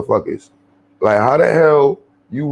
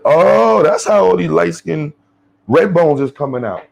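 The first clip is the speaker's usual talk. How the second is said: retold realization; 6 semitones higher than usual. Man retelling his sudden realization about mixed couples, exclaiming. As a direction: burst out as it suddenly clicks.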